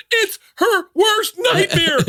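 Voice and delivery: very high, slow, crying delivery; high mock-sobbing cry; hammed-up wail. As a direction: wail it like a horrified kid. Sister wailing about the bees.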